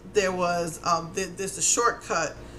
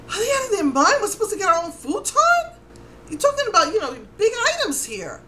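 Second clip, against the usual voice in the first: are you kidding me, pitched high; stunned at carrying furniture herself; outraged disbelief; 11 semitones higher than usual. Retold or imitated. Told to fetch big items herself.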